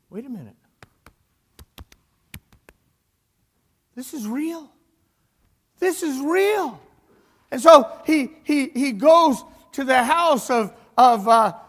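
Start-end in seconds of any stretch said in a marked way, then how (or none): 0.1-4.7 softer, hushed voicing of disbelief; dawning realisation. Peter to himself outside the prison, quiet realisation. 5.8-6.8 higher, slower, emphatic exclamation; astonished realisation. Retold or imitated. Peter realising he is free, amazed emphatic cry.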